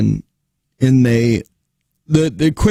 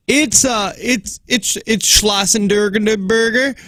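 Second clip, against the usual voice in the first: higher, slow, stammering caller; imitating a caller; 6 semitones higher, slower than usual. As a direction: drag out the hard name slowly. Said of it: "when people will be like".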